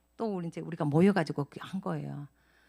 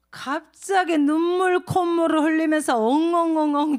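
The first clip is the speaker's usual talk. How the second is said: mock sobbing sounds; 8 semitones higher, 8 dB louder than usual. Imitates husband sobbing.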